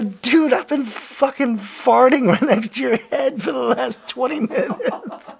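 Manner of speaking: high, loud confession through laughter; laughing shout. Retold or imitated. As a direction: yell it while cracking up. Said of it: "I go".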